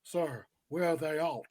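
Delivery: low, quiet bad actor impression; mock a half-hearted film delivery, soft and low; hushed imitation breaking off into a laugh; Nicolas Cage. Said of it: "Here's Nicolas Cage".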